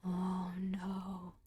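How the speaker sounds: soft, low dismayed sigh; softly. A narrator's reading. Skull recognizing the Lady Amalthea, softly. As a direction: breathe it in quiet dismay. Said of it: "it said softly".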